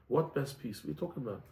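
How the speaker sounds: low, hushed puzzlement; hushed puzzled Roman. Acted. Roman whispering back to the rabbi, hushed and puzzled. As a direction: murmur back, confused.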